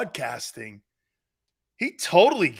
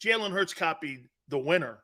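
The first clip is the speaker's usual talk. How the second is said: normal talking voice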